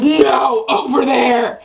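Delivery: loud shout at daughter; shouted order. Retold or imitated. Mom shouting at daughter, loud. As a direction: bark it across the room. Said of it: "She goes".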